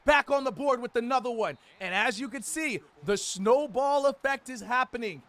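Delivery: excited broadcast commentary; play-by-play. Acted. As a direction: call the game like a TV announcer. Live play-by-play of a game.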